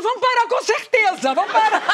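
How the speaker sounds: bem aguda e alta, em pânico; Mônica certa do desastre; apavorada. Retold or imitated. (Mônica apavorada achando que o carro vai parar)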